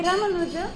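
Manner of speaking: "Ramanuja" with ma kept short; short second syllable. Shown as a demonstration. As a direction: show the mistaken "Ramanuja", RA-ma-nuja. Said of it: "you make the second syllable".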